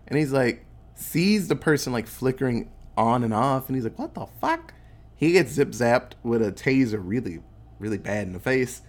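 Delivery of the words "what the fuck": pitched way up, stunned; a guard who can't believe his eyes; high shocked squeak; startled guard. Acted. Guard sees flickering invisible man.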